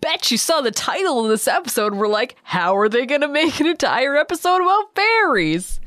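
high, incredulous mock viewer voice; mocking impersonation. Poking fun at the podcast's listeners, mocking impersonation. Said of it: "offended by this impersonation of myself".

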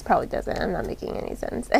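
quick, self-mocking whisper; whispered aside. Real, not acted. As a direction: mutter it to yourself, embarrassed. Self-deprecating aside to the viewers.

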